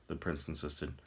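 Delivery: ordinary delivery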